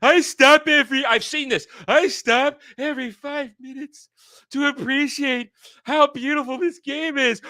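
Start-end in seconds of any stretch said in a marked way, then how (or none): 0.0-1.0 high, loud mocking gusher; gushing shill. Shill raving about how beautiful the game is, mock gushing. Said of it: "Who the fuck talks like that?". 1.9-7.4 high-pitched mocking fanboy awe; gushing shill. Shill raving about how beautiful the game is, mock gushing. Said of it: "Who the fuck talks like that?".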